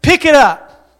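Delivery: preacher echoing God's command to the congregation; urgent echo. Shown as a demonstration. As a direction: hammer the command home.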